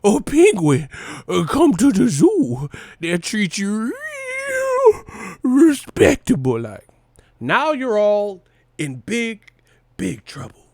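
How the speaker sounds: high, slow, squeaky movie voice; Billy Madison impression. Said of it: "Billy Madison ass shit".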